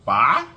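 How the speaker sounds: "Pho" as "fuh?", loud and rising sharply; proper Vietnamese pronunciation. Shown as a demonstration. Vlogger showing the proper way, "Pho" as rising "fuh?". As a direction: swoop "Pho" upward like asking "fuh?". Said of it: "That's the proper way they say".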